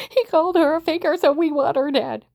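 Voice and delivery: high, loud mocking outcry; mock yell. Retelling what accusers said about her, high mock yell. Acts out accusers' angry claim.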